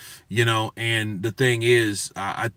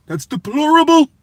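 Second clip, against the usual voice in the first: very high, loud exclamation of outrage; hammed-up impression, pitched way up on the last word; Chris Eubank Senior; 7 dB louder than usual. Condemning bad pronunciation, a high, loud mock-indignant impression. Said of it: "mindset of, of Chris Eubank Senior".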